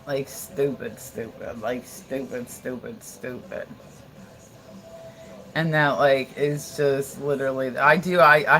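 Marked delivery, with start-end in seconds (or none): 0.1-3.7 hushed, low disbelief; low, deadpan emphasis. Real, not acted. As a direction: drop low and soft, repeating each one. Dwelling in disgust on how stupid.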